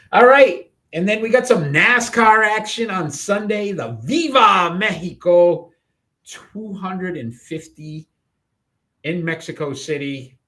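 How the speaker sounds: host opening the nascar segment; announcer voice. Acted. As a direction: hype the race like a TV host.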